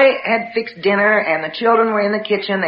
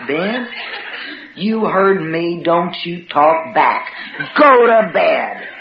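slow, emphatic scolding, word by word; stern mother; 2 semitones lower, much slower than usual. Retold or imitated. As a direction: spell it out to a disobedient son. Mother disciplining son, words spaced out.

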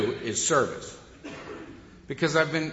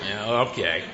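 dog's unimpressed reply; 7 semitones lower than usual. Retold or imitated. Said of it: "She looks at me like".

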